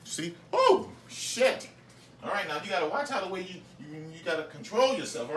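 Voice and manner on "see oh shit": much higher startled cry; high startled oh shit; surprised yelp. Real, not acted. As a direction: jump at the mishap. Reacting to seasoning spill.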